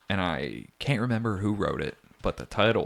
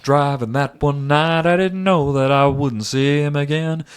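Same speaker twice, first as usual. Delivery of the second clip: raised heartfelt country ballad delivery; country singer voice; 5 semitones higher, 6 dB louder than usual. Pretend. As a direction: tell it like a sad country song. Parodies [name] song lyrics.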